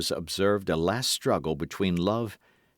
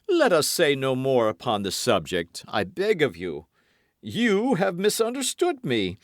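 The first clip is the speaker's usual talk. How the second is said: lightly voiced swaggering musketeer, raised pitch; Porthos voiced; 8 semitones higher, 3 dB louder than usual. A narrator's reading. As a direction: play Porthos pleading with mock hurt. Quarrel between the two.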